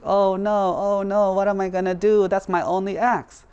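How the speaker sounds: high, hammed-up wailing; fake crying. Dishonest woodcutter pretends to cry by the river, fake wailing. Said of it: "pretends to start crying".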